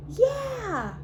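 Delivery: high, loud yeah; excited cheer. Acted. Dewey's happy cheer on getting a propeller, high and loud. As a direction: cheer like a delighted kid.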